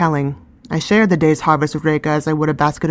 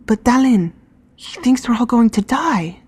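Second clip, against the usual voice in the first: whispered; 7 semitones higher than usual. A narrator's reading. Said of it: "Reka whispered to me".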